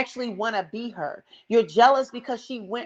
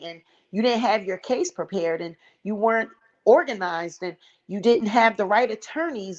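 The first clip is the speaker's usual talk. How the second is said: ordinary talk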